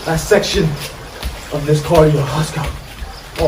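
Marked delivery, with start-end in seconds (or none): 2.4-3.5 breathy whispered push; soft whispered encouragement. Acted. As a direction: whisper the cue under your breath. Workout coach cheering on.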